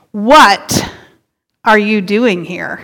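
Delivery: WHAT punched, then a pause; stress on WHAT. Shown as a demonstration. Preacher shows congregation how emphasis changes the question, stress on WHAT. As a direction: lean hard on "What", set it apart from the rest. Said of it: "depending on what word you emphasize".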